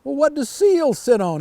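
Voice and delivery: high, eager frog question; curious frog. A narrator's reading. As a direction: ask like a nosy little frog. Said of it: "asked the frog".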